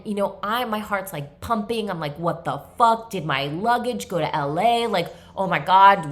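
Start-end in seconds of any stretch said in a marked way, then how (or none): 2.2-6.1 a touch of panic; reliving the panic, a little louder; mock panic. Retelling a panic over lost luggage, mock alarm.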